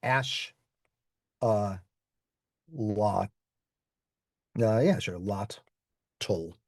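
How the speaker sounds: "ash, lot, tul" sounded out with SH; slow syllables "ash", "lot", "tul"; Mexican pronunciation. Shown as a demonstration. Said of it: "what they will all say is".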